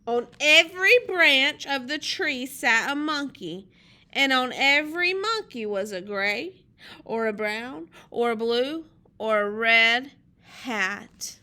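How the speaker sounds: put-on loud, animated storytelling to children; lively picture-book narration; story read-aloud. A narrator's reading. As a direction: read it out big, landing on each key word.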